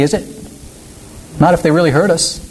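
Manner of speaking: normal talking voice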